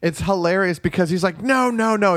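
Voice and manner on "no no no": high, repeated director's interruption; mock exasperated. Podcaster imitating man halting choir practice, mock exasperated. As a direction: cut them off like a fussy conductor. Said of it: "He stops the whole performance".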